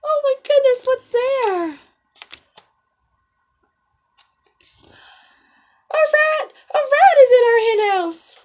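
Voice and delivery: high, loud hen panic; panicked hens. Acted. Hens panicking about the rat in the hen house. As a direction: cry out in alarm.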